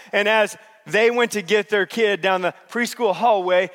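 ordinary delivery

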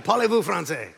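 badly faked French; joking attempt at spoken French; mock French accent. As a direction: put on a hammy French accent. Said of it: "no idea how to speak French".

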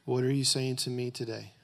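quiet, low prayer over music; soft prayer. Acted.

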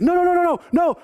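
high, loud panicked no's; panicked robber. Retold or imitated. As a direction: back away in a panic. Robber backing off, hands up.

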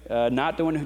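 normal talking voice

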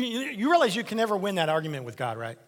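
ordinary delivery

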